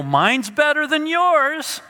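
high, slow, gloating sing-song; childish bragging; smug boast. Retold or imitated. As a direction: rub their nose in it. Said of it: "they go".

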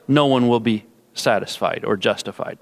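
ordinary delivery

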